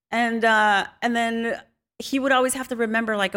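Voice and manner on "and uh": ordinary talk, nothing marked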